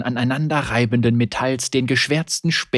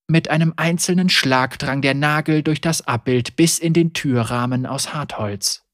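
plain reading voice

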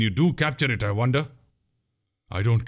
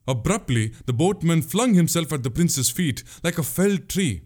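ordinary delivery

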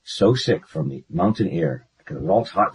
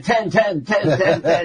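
high, rapid comic pleading; imitating a desperate child, pitch way up; hammed-up panicked repetition; mock panic; 9 semitones higher, slower than usual. Child panics during the count.

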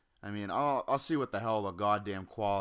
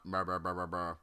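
softer, dismissive blah blah; 5 semitones lower, 4 dB quieter than usual. Acted. Waving off the sponsor read, softer and dismissive. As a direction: brush the script off.

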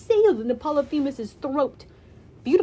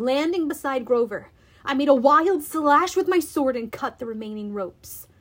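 ordinary delivery

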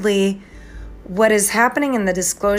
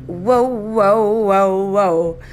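mock amazed whoa; much slower than usual. Real, not acted. Vlogger marvelling at the Lion's gate. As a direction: ride the wonder of it.

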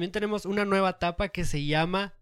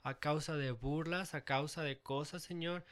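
prayer; 8 dB quieter than usual. Acted. Prayer for listeners.

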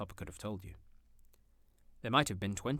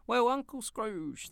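much higher, slow, voiced as a crowd; guests toasting; 13 semitones higher, 6 dB louder, much slower than usual. A narrator's reading. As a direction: raise a glass together. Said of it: "they cried".

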